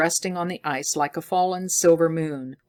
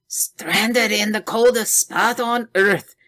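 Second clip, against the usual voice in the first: slow, despairing groan, higher and louder; 6 semitones higher, 5 dB louder than usual. A narrator's reading. Scientist stranded on the ice, groaning slowly. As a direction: moan it out in despair. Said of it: "groaned the scientist".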